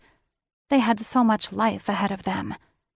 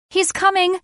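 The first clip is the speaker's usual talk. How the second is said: high, loud panicked cry; 13 semitones higher, 6 dB louder than usual. A narrator's reading. Lily warns everyone, shrieking in panic. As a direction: shriek the warning.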